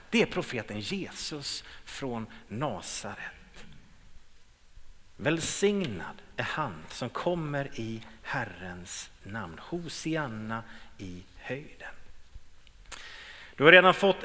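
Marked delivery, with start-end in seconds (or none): 0.1-11.9 reverent praise. Retold or imitated. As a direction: lower and softer, slow worshipful proclamation. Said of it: "de svarar så här". (lågt och vördnadsfullt lovprisande)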